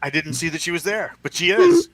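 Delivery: sudden high, loud shout of surprise; startled yelp; surprised. Real, not acted. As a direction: blurt it out, caught off guard. Wife showed up, surprise.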